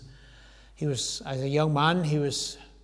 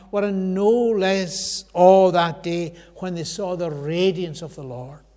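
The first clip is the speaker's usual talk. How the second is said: usual speaking voice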